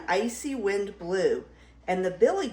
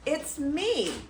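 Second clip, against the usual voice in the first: higher-pitched, lightly bleated reply; bleating goat; 6 semitones higher than usual. Acted. Middle Gruff answering the troll, light high bleat. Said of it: "Middle Gruff bleated".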